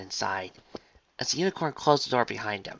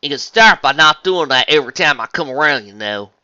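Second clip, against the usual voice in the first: southern twang; 4 semitones higher, 12 dB louder than usual. Acted. Farmer teases her friend at the door, southern twang. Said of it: "said a familiar, lighthearted southern twang".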